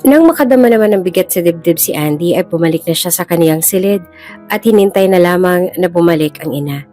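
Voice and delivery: normal talking voice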